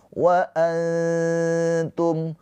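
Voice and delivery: high, slow antum with a long blurred nasal before t; veiled nun. Shown as a demonstration. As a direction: hover near t while humming through antum. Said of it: "posisi lidah kita mendekat ke huruf ta". (antum dengan nun samar, ditahan lama)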